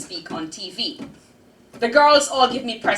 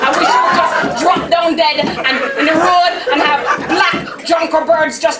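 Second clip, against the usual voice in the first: loud, higher rage hurled at the aunt; furious cursing; 7 semitones higher, 12 dB louder than usual. Acted. Girl rages at Aunt June in her head, loud curses. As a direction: spit out every curse you can find.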